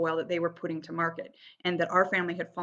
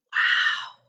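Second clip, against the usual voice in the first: breathy wow. Retold or imitated. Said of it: "they smell it and go".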